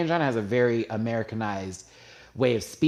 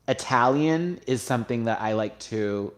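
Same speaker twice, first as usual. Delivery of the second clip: usual speaking voice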